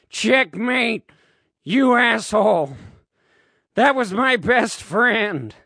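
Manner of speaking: loud, high vengeful outburst; angry shout. A narrator's reading. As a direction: hurl it at the enemy in fury. Slaps king, avenges fallen friend.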